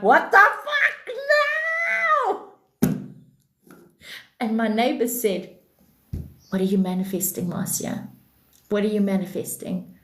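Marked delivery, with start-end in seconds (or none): 0.0-2.5 very high, drawn-out exasperated wail; outraged shriek. Retold or imitated. Reacting to power cut returning. 6.5-9.9 low, dry, suspicious questioning; deadpan questioning neighbor. Retold or imitated.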